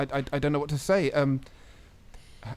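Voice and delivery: ordinary delivery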